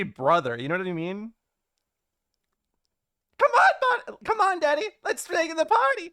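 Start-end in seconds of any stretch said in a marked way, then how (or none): 3.4-6.1 much higher, louder cartoonish comrade voice; silly high voice. Streamer jokes to chat in a hammed-up high recruiter voice. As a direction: squeal it like a hammy party recruiter.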